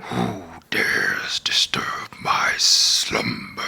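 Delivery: deep, slow, whispered cave voice; a huge voice rumbling from a cave; Cave of Wonders voice. Shown as a demonstration. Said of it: "That was pretty good".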